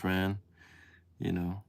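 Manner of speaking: usual speaking voice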